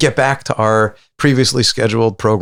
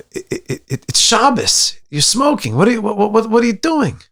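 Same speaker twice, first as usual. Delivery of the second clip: shocked rabbi; 12 semitones higher than usual. Retold or imitated. Rabbi confronting boys in barn, high outraged scolding. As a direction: scold the boys, voice shooting up in disbelief.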